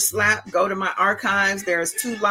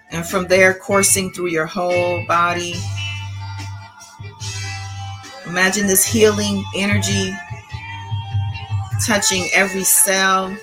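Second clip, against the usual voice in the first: calm meditative tone. Acted. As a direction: guide them gently into calm breathing. Leads a guided breathing meditation.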